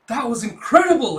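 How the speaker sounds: high, loud amazed exclamation; awed excitement. Acted. Rescued lady amazed by Yor's catch, excited. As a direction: burst out in admiration. Looking at her in shock and awe.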